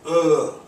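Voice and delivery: loud, high groan of disgust; demonstrated disgusted "ugh", voice pushed up; natural interjection. Shown as a demonstration. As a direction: groan the sound "ugh" with no hard g. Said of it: "that's just what that's supposed to say".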